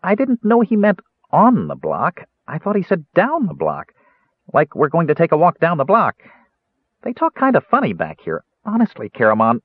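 high, bright-pitched kender chatter; cheerful Kender. A narrator's reading. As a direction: play the eager little Kender, voice up high. Kender voiced by reader.

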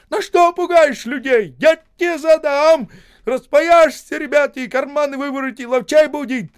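loud, high bossy yell; shouting orders. A narrator's reading. Osip shouting at the men crossing the ice. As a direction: bark commands across the ice.

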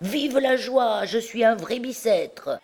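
high-pitched, drawn-out reveller's cheer; drunken glee. A narrator's reading. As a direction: crow it out like a merry tavern drunk.

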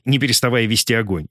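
plain reading voice

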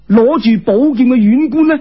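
ordinary delivery, nothing marked

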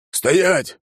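high, sharp command to halt; narrated shout, pitched far up; shouted order. A narrator's reading. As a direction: call out an order from the back. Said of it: "крикнул кто-то".

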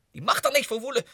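high, loud retort; heated outburst. Acted. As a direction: snap it with conviction. Heated political rant. (fel uitgeroepen)

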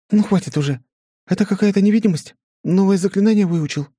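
put-on high, quick questioning girl; curious young woman voice. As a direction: fire questions high and fast. Flora quizzes husband.

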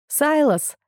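high call across the cave. A narrator's reading. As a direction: call his name urgently. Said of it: "позвала я".

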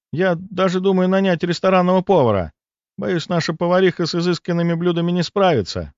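slightly performed, high-pitched female character; lightly voiced hostess. A narrator's reading. Narrator voices Lida's dialogue. (слегка озвученная хозяйка, высоким голосом)

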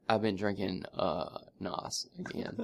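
soft, slow confessing mumble; mock guilty confession. Husband confessing to wife, soft slow guilty voice. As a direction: confess it quietly, ashamed. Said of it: "I was like".

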